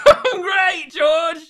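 high, loud hammed-up shout; exaggerated enthusiasm. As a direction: shout it back with over-the-top cheer. Overdoes the demanded enthusiasm.

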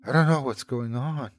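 usual speaking voice

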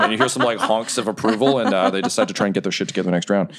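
ordinary delivery

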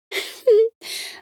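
short high giggle; a little bubbly titter, acted; light, bubbly laugh. Friendly teddy bear greeting children, light bubbly giggle. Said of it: "let out a light, bubbly laugh".

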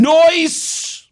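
high, drawn-out mock-cool cry; hammed-up high goofy exclamation; wannabe-cool dad. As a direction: mimic the texter's slang with goofy relish. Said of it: "Okay, here's him".

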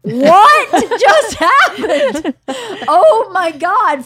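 slow, high, laughing astonishment; giggling what-just-happened; stunned disbelief. Retold or imitated. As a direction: drag it out in amazed laughter. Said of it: "I was behind Georgia going".

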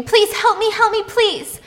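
acted scream, pitched far up and urgent; screamed plea. Noah calls to the voice through the wall, screaming. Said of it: "Noah starts screaming".

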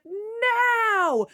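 high, drawn-out shout; shouted signal. Acted. Jack shouts the signal from the roof, long high shout. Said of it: "and Jack shouted".

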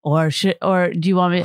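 normal talking voice

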